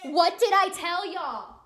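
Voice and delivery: slow, high, very loud outburst; exasperated shout. Real, not acted. Teen mom to viewers as the baby cries as predicted, exasperated shout. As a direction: shout I-told-you-so at the viewers.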